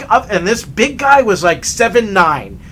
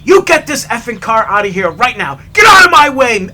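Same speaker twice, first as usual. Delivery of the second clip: loud shout building higher; imitated angry screaming; 3 semitones higher, 7 dB louder than usual. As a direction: yell like an enraged driver. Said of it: "he starts screaming".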